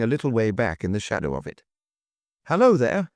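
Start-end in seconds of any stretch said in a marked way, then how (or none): 2.4-3.1 high, louder hail; calling out. A narrator's reading. Duke to the cloakroom attendant, calling out. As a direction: call out to attract attention. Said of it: "called out the duke".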